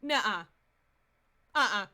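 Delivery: normal talking voice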